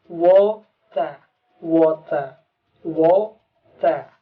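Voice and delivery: water said British, wota, T kept and R dropped; British water with a crisp T; British pronunciation. Shown as a demonstration. Teacher demonstrating British water. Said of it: "British akan dibaca T-nya tetap T".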